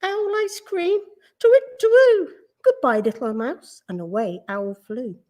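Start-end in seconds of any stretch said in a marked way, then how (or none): 0.0-1.0 high, shocked echo of the words; alarmed question. A narrator's reading. Owl alarmed at being food. 1.4-3.8 high, loud storybook owl hoot; alarmed owl. A narrator's reading. Frightened owl hoots and leaves.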